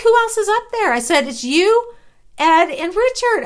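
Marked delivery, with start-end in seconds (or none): 0.0-0.9 high, louder incredulous question; imitating disbelief. Friend doubts who came outside, high-pitched disbelief. 1.3-3.4 high, loud, emphatic comeback; retold insistence. Woman answers her doubting friend, re-enacted insistence. She argues back in retold dispute.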